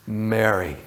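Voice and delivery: low, soft and tender; tender name. Retold or imitated. Jesus gently calling Mary by her name, tender. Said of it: "Jesus says".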